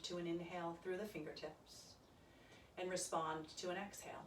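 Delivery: soft, calm yoga meditation voice; quiet guided meditation for a yoga class; guided relaxation. Acted. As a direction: ease them into their breath gently.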